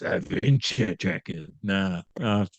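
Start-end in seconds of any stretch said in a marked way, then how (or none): 0.0-1.5 deep hyped announcer call. Shown as a demonstration. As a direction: boom it out like a ring announcer. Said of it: "Can I do that in a more announcer voice?".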